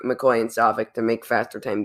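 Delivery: usual speaking voice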